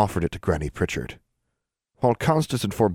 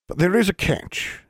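put-on old granny voice; 13 semitones higher than usual. A narrator's reading.

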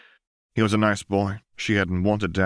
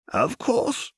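put-on higher, frail voice of a tottering old man; old archivist. A narrator's reading. Old archivist greets Lux, higher and frail. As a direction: offer help like a doddering old keeper.

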